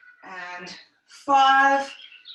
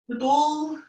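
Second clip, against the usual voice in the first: ordinary talk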